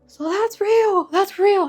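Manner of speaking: high, loud stunned cry; shocked realization. Retold or imitated. Feeling the drool burn, she cries out in high, loud shock. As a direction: blurt it out in disbelief.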